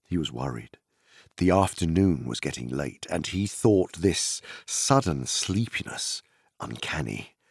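ordinary delivery

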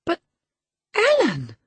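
put-on high, loud girlish protest; astonished cry. A narrator's reading. Girl appealing to her nurse, astonished high cry. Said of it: "staring fixed in astonishment".

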